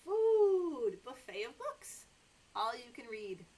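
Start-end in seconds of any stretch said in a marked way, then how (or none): none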